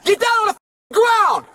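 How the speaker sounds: shouted police commands, high and urgent; shouting. Real, not acted. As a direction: bark it out at an armed man. Officer confronting armed man.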